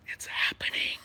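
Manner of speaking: thrilled unvoiced whisper. A kid watching the meeting, excited whisper. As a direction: hush it to yourself, delighted.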